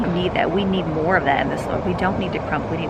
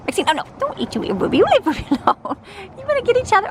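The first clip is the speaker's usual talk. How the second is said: higher and louder, a squeaky dog telling off another; high scolding dog voice; 4 dB louder, faster than usual. Pretend. Ruby the dog ordering Maxine to leave her alone, in a high loud voice. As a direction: play the bossy little dog, laughing through it.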